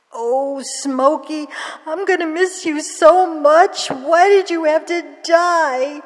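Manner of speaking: high, loud, wailing sobs over a lost pet; crying. Acted. Girl crying over her dead cat's grave, loud wailing sobs. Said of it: "Then she started to cry".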